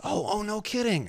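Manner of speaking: high-pitched "oh, really" reaction; put-on polite amazement; mock surprise. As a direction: act innocently taken aback. Pastor's wary mock surprise.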